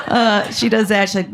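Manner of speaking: ordinary talk, nothing marked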